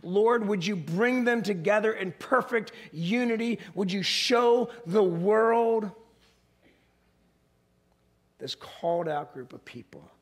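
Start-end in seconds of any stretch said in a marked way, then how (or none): none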